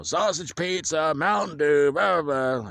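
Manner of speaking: high, loud concession-stand holler, drawn out; imitated vendor yelling. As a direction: mimic vendors hollering their wares. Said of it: "screaming out".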